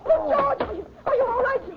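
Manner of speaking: panicked concern. Acted. Wife rushes to husband after struggle.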